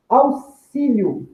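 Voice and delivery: "Auxílio" said very slowly, stress on XÍ; teacher stretching out "Auxílio", the final "io" as one glide; slow read-out. Shown as a demonstration. Teacher reading the quiz options, slowly saying "Auxílio". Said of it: "eu falo ele num só impulso".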